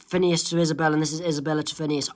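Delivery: plain reading voice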